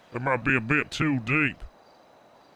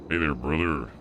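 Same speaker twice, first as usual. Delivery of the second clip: deeper, machine-like voice changer, lower; robotic voice; 8 semitones lower than usual. Pretend. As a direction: greet like a flat robot through a voice filter. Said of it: "it does sound a little bit robotic".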